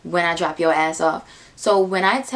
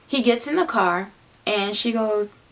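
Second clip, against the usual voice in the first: ordinary talk, nothing marked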